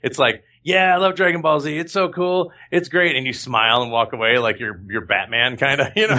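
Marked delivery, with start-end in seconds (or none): 0.6-3.2 higher-pitched, gushing fan voice. Acted. Undercover star agreeing he loves the show, gushing. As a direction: play the keen admirer, pitched up. Plays fan hiding his secret identity.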